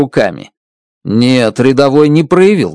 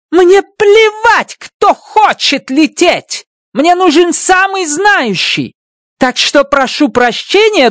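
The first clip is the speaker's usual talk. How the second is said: high, slow, stressed commands; furious order. Acted. Stratt slams the table, ordering Grace to fly. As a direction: hammer each word home.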